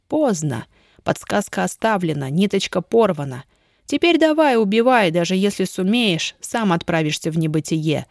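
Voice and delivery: plain reading voice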